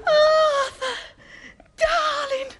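high, loud cry of delight; overjoyed. Acted. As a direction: squeal his name with joy. Delighted reply to proposal.

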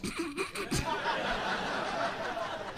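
high, soft put-on cackle; long high fake laughing; mock host laugh. TV host laughing at celebrity names, mock cackle. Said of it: "laughing when he says the people's names".

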